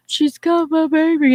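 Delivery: gasp, then high loud wail; mock-distraught slow, high cry; imitated devastated dog. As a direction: play a dog in tears over a stolen baby. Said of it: "devastated. She's like".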